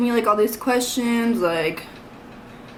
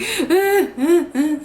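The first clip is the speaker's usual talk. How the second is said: half-laughing sob, pitched higher; a mock wail, crying and laughing at once; retold sobbing; 7 semitones higher than usual. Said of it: "I'm bawling my eyes out".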